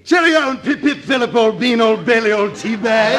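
hammed-up, high English toff accent; affected English accent. Guitarist greets bandleader after being called unimpressed, affected English accent. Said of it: "he'll be affecting an English accent".

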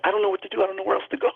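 high-pitched imitation of a frightened girlfriend; retold scared panic. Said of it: "because she was so scared".

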